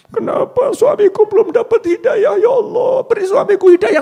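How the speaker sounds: high, loud tearful wail to God; wife's wailing prayer. Retold or imitated. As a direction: cry out to Allah like a despairing wife. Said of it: "Ngono, ndonga".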